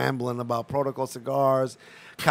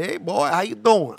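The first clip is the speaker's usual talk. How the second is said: southern trooper greeting; 7 semitones higher, 5 dB louder than usual. Retold or imitated.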